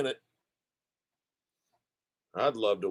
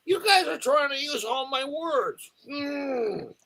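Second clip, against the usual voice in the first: mock whine; slower than usual. Mock complaint, crying sound.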